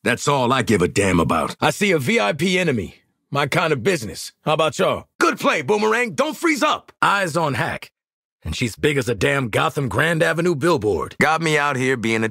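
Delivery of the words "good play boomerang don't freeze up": high-pitched shout-out to a teammate; urgent, raised encouragement; combat callout. Acted. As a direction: call across the fight to your squad.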